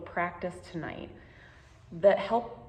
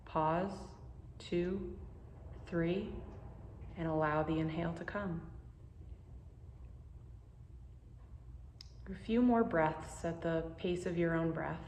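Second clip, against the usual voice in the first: guided meditation; 5 dB quieter than usual. Acted. Teacher guiding listeners through a pause practice, calm and unhurried. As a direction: ease the listener into calm, one breath at a time.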